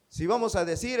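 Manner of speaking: ordinary talk, nothing marked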